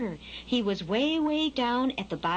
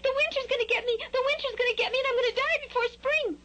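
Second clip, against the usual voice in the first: very high, monotone panicked squeal; hammed-up chick hysteria, pitched way up; screamed; faster than usual. A narrator's reading. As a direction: wail in a tiny bird's panic. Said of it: "screamed Skylark".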